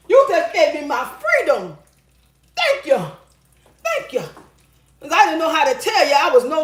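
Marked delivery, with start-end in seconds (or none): 2.5-4.2 mock grateful thanks. Mock thanking the man for leaving.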